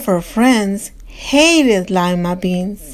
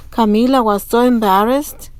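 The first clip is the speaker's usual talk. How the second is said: ordinary delivery, nothing marked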